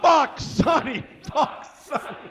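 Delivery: ordinary talk, nothing marked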